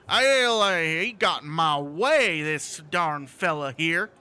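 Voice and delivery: loud drawn-out opening, then slow complaint; put-on teamster voice. Teamster friend blames the fallen man, put-on teamster voice. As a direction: holler it as a folksy wagon driver.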